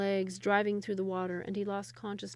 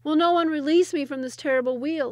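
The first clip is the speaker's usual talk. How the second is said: louder, higher despairing moan; 7 semitones higher, 10 dB louder than usual. Acted. Trapped Sultan moans from the wheel, loud and despairing. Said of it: "he moaned daily".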